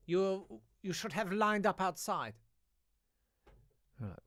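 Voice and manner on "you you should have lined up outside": lightly voiced stern teacher, higher pitch; mock scolding, pitched up. Retold strict German teacher scolding.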